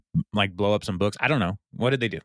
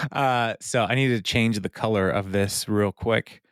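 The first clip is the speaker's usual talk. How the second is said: ordinary delivery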